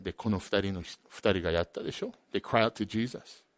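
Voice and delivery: normal talking voice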